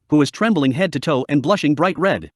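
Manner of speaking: ordinary delivery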